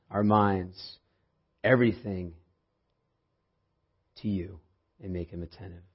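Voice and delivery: soft, hushed prayer voice. Acted. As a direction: pray quietly and reverently. Opening prayer before sermon.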